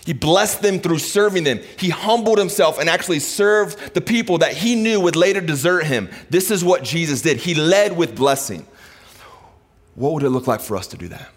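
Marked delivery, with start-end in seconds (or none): 0.0-8.7 raised, ringing preacher's voice; preaching. Acted. Pastor preaching to the congregation, raised and fervent. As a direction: preach it out to the church.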